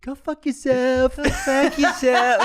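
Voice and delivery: high, slow drawn-out mock insult; imitating Les Grossman. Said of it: "same as always".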